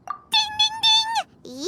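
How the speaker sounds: high, sing-song game-show chime; quiz bell ding. Pretend. Cheerful quiz host chiming the right answer to her friend. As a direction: ring it out like a correct-answer bell.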